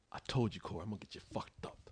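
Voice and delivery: hushed whisper of the brag; whispered boast. Retold or imitated. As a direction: breathe it like a secret. Quoting the friend's boast.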